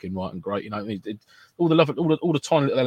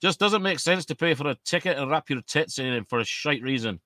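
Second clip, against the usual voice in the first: slow, put-on Scottish accent, slightly raised; 4 semitones higher than usual. Shown as a demonstration. Co-host showing how the comment should sound, Scottish accent. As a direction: take it slowly in a Scottish accent. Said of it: "you've got to say it in a Scottish accent".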